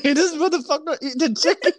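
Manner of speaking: high, quick mock outrage through laughter; cracking up. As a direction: blurt the imagined reaction while laughing. Cracking up over imagined DoorDash reaction.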